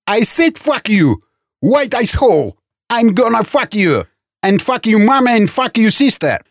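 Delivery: high-pitched angry tirade; furious cursing. A narrator's reading. Man insulting the guard in a quarrel, furious cursing. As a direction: hurl each insult up high.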